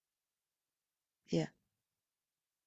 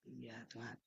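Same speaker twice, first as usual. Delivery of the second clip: barely audible sigh to God; whispered prayer; 16 dB quieter than usual. Real, not acted. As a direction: breathe the words as a private prayer. Quiet prayer amid testimony.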